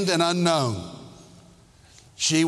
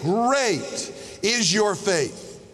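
"great is your faith" drawn out slowly; slow, weighty praise; 3 semitones higher, much slower than usual. Acted. Jesus commending the woman, slow and weighty. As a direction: savour every word of praise.

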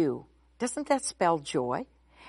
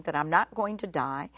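ordinary talk

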